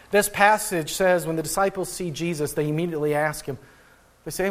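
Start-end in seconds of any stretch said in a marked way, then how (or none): none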